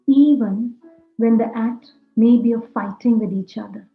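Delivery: ordinary talk, nothing marked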